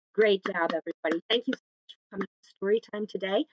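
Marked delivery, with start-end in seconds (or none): none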